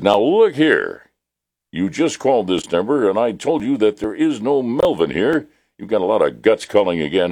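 angry man on the phone slamming down. Acted. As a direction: scold the repeat caller sharply. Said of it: "You see, that was anger".

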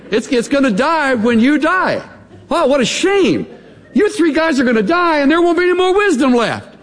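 high, mocking y'all sarcasm with a laugh; sarcastic y'all. Job ridicules his three friends, high and mocking. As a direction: ham up Job's scorn for the three friends. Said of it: "having learned a little sarcasm".

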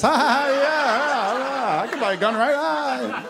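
a high, forced, nervous mock laugh; nervous fake laugh. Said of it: "you're like".

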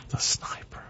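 breathy mock-secret whisper of "the sniper". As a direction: whisper it like a secret code name. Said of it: "And you gotta whisper it".

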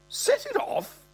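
very high squawk of disbelief; old man's affronted yelp; incredulous old man. Acted. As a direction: echo it back in high-pitched shock. Old man's shocked echo.

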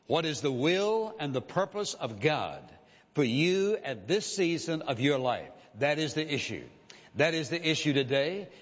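usual speaking voice

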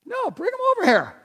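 high-pitched, emphatic call; acted high, urgent counter-order; insistent calling out. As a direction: overrule them, calling the kids over. Acting Jesus overruling the disciples.

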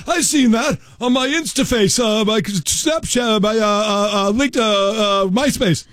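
put-on high, fumbling old dad voice; excited dad rambling. Dad rambling about social media.

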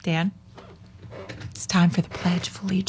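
hushed, breath-only cue; quick whispered nudge off to the side; subtle whispered reminder. Real, not acted. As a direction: whisper a discreet prompt to a colleague. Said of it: "That's how we subtly remind".

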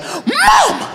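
put-on very high, strained kid's outburst; snapped child. Brother snapping at mom in the store, shrill mock outburst. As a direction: imitate a little brother losing it in a squeal. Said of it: "my brother just snapped".

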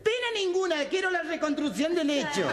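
very high camp punchline voice; camp caricature. Acted. Camp victim asks the commissioner for a reenactment, very high voice. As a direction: sell the punchline in a squealing camp voice.

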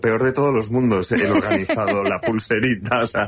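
amused retort, pitch lifting at both ends; amused, near laughing. Real, not acted. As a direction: talk through a real, suppressed chuckle. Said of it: "me estaba riendo".

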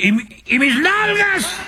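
high bewildered frog voice; frog's question. Acted. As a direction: ask it up high, lost. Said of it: "decía".

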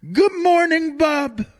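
high squawky parrot voice. Retold or imitated. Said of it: "did he have a Jamaican accent".

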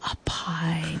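whispered, after a delighted gasp; hushed awe. Real, not acted. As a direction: whisper it like a wonderful idea. Whispering longingly at the idea of pie.

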